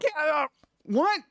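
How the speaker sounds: high, slow stunned "what?"; shocked disbelief. Retold or imitated. Hearing Pirates is twenty years old, stunned disbelief. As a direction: retell the jolt of learning it's twenty years old. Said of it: "I'm like".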